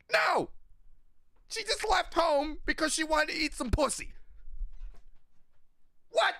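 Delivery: high, incredulous outburst; indignant. Real, not acted. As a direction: blurt out the letdown in outrage. Punchline of a rant about the twist.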